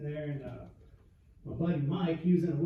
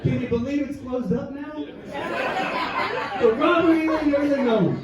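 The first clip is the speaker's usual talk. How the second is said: much louder, higher, laughing; laughing disbelief; 9 semitones higher, 9 dB louder than usual. Real, not acted. Laughing repeat of the name.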